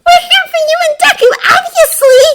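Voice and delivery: very high, fast, strained shout; whisper-shout. A narrator's reading. Uraraka answers Bakugo behind cover, whisper-shouting back. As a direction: shoot back urgently in a squeezed high voice. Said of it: "Uraraka whisper shouts back".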